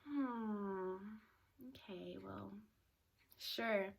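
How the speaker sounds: very soft, near-whispered reply; hushed hesitant acceptance. Retold or imitated. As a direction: give in quietly, with pauses. Hesitant answer to being asked out.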